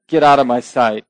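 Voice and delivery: loud mock outburst from the king; retold angry rant. King ranting at the queen, a loud mock outburst. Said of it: "kinda goes on a little rant".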